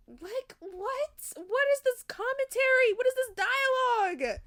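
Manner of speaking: high, loud outrage at the dialogue; shocked disbelief. Real, not acted. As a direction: shriek it in stunned disbelief.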